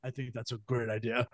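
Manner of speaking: low, soft American reply; underplay it with no accent. Retold or imitated. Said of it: "he didn't do an accent".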